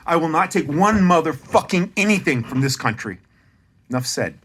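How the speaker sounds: loud defiant declaration; loud rant. Real, not acted. Ranting about honors from his country, loud and defiant. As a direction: declare it loudly, fed up.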